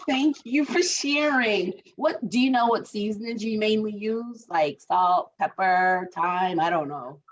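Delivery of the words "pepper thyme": usual speaking voice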